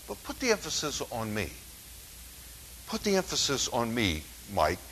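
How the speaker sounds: softly spoken word from God; God's quiet prompting. Retold or imitated. God speaking to the preacher this week, softly. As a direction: say it softly, as God gently redirecting. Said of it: "what God was speaking to me".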